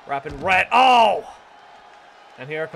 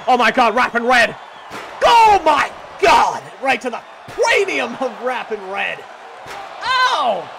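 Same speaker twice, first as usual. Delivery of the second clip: screaming much higher and louder in shock; excited shout; 6 semitones higher, 7 dB louder than usual. Real, not acted. Commentator reacting to a chair shot on Rappin' Red, shrieking. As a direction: lose it over the big hit, full yell. Big hit in the match.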